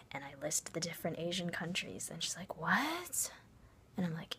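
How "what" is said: high, drawn-out confused "What?"; mock bafflement. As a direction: imitate a totally lost friend. Friend baffled by list of countries.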